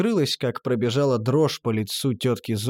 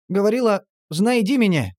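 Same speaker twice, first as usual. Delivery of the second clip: quoted plea; 7 semitones higher, 3 dB louder than usual. A narrator's reading. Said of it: "слезливая передача".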